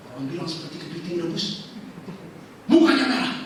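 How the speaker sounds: ordinary talk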